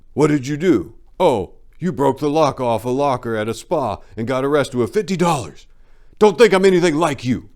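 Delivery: loud, high mock taunting of the punk. Acted taunting of a prisoner.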